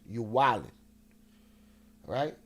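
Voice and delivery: usual speaking voice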